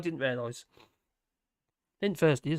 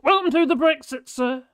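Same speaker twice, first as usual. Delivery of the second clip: higher, louder mock-grand welcome; 7 semitones higher, 8 dB louder than usual. Greeting a viewer with a joke, mock-grand welcome. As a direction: announce it like a pompous doorman. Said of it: "Face, welcome to Brexit".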